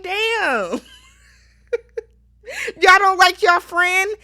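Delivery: high, amused disbelief breaking into a laugh; stunned disbelief. Real, not acted. Reacting to her friends' harsh confessional, shocked and laughing. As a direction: gasp at how the friends talk about her.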